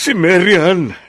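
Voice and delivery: urgent call. Acted. Urgent news about daughter.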